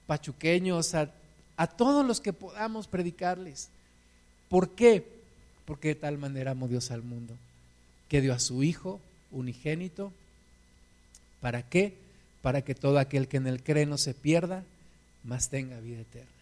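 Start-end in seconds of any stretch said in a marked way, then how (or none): 5.7-16.3 baja la voz y recita con ternura; recitación suave. Real, not acted. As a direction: como una confidencia al corazón. Versículo repetido suave. (recita el versículo con voz suave)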